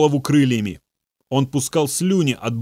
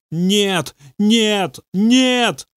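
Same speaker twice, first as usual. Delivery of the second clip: whining; 8 semitones higher, much slower than usual. A narrator's reading. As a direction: whimper each no higher than the last. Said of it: "конючил он". (демон ноет, срываясь всё выше и громче)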